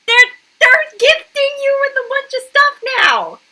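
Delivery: high, squeaky incredulous outburst; incredulous squeal. Real, not acted. Reacting to the parents' gifts, a high incredulous squeal. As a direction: squeal it in disbelief.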